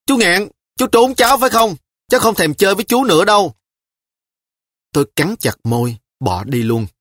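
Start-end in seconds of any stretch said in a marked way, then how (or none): 0.0-3.5 put-on much higher, fast child's shout after him; a girl shouting at her uncle who slipped away; calling after. A narrator's reading. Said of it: "gọi với theo".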